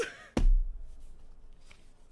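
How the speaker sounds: breathy high-pitched laugh burst; high squealing laugh. Real, not acted.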